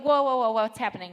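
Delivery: loud, mildly startled interruption; retold alarm. Mother interrupts her cheering son, mock startled. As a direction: break in as if halting a child, lightly startled. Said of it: "And I was like".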